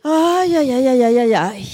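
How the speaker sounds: loud, high theatrical wail of ay ay ay; hammed-up lament; mock wailing ay ay ay. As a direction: wail it out like a melodrama. Lamenting over attachments.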